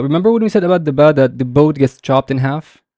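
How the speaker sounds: normal talking voice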